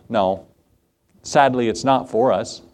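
normal talking voice

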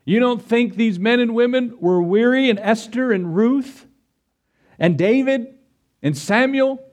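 high, rising preacher's build; preacher building to a fervent peak; preaching at full force. Acted. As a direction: drive the list home with fervor.

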